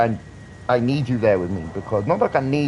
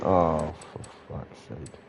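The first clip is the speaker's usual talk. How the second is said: under-the-breath exasperated curse, much softer; exasperated mutter; 6 semitones lower, 8 dB quieter, slower than usual. Retold or imitated. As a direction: mutter it like a fed-up bystander. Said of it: "everyone around going".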